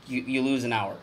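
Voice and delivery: ordinary talk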